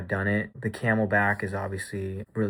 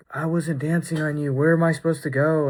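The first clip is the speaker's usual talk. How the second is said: imitating a drunk Frenchman's thick accent, higher-pitched; thick foreign accent; 6 semitones higher, 3 dB louder than usual. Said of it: "in this really thick accent".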